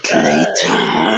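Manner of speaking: villain boss voice. Pretend. Ends the boss voice, very low.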